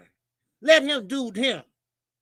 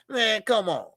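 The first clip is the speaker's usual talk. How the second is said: ordinary talk, nothing marked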